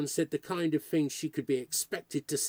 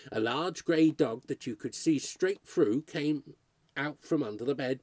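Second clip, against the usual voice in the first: ordinary delivery, nothing marked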